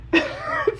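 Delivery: high, amused laugh; high-pitched cracking up. Real, not acted. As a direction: burst out laughing at your own story. Laughs at own story.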